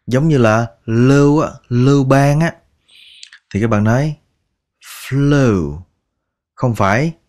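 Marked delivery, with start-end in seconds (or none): none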